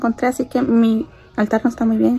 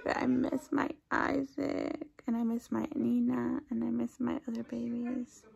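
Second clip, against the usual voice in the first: sad; 10 dB quieter, slower than usual. Real, not acted. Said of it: "so sad".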